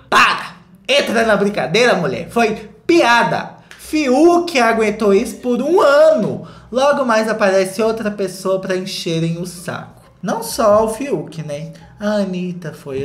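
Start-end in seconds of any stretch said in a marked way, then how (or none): 0.1-9.5 scolding comment. Retold or imitated. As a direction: tell her off emphatically. Reading scolding comments aloud.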